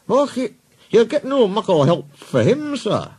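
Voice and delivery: high Scottish character voice; Scottish accent. Acted. Twin engine answering the boss, Scottish accent.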